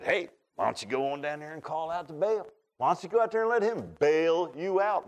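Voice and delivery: mildly sarcastic suggestion; smart aleck. Acted. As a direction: toss it out as a smart-aleck retort. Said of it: "I can be smart aleck sometimes".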